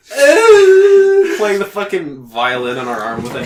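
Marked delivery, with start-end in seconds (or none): 0.1-1.4 high, loud hammed-up wail; a fake crying whimper for laughs; mock sob. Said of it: "she's like".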